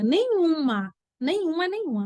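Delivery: nenhuma repeated three times for emphasis; insistent teacher emphasis; emphatic repetition. Real, not acted. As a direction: hammer home nenhuma with every repeat. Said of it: "emphatic repetition".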